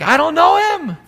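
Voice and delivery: high, loud panicked denial. Acted. Peter denying Jesus, a high panicked blurt. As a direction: deny him in a frightened rush.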